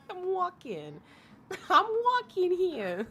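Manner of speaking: high-pitched hammed-up New York accent; laugh, then ham up the New Yorker. Streamer clowning for a New York viewer, high mock New York accent. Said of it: "You live in New York?".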